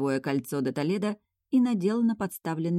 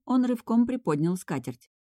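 ordinary delivery

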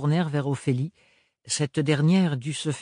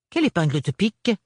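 higher, louder puzzled ask; surprised question; 5 semitones higher, 4 dB louder, faster than usual. A narrator's reading. Aunt Roseline seeing Ophélie rush off, surprised. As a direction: ask it startled. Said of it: "s'étonna la tante Roseline".

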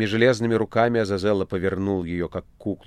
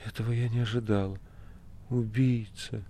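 whispered; 2 semitones higher, 6 dB quieter than usual. A narrator's reading. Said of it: "прошептала".

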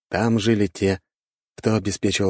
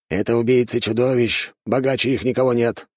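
higher-pitched, mildly grumbling elder; lightly grumbling old man; 6 semitones higher than usual. A narrator's reading. Red-bearded elder explains the monster slayers, mildly grumbling. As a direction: grumble a little in a raised voice. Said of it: "проворчал рыжебородый старик".

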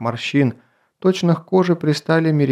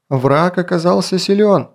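higher, louder warrior's retort; raised voice; 6 semitones higher, 4 dB louder than usual. A narrator's reading. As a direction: raise your voice to argue. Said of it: "повысил голос рубиновый мечник".

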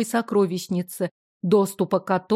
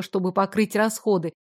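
ordinary delivery

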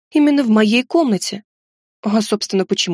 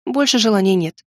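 usual speaking voice

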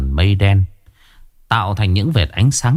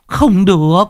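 high, loud alarmed cry; alarmed refusal; 10 semitones higher, 4 dB louder than usual. A narrator's reading. Old neighbour refusing Uyên's plan, high alarmed cry. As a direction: cut her off in shock.

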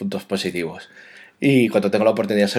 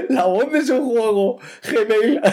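laughing; 11 semitones higher, slower than usual. Real, not acted. Laughing after botching the email.

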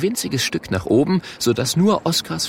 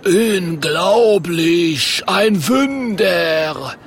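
very slow, high, loud marvelling; amazed cry; 8 semitones higher, 4 dB louder, much slower than usual. Acted. Troll seeing the finished writing, drawn-out amazed call. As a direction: stretch each syllable in wonder. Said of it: "ruft der Troll".

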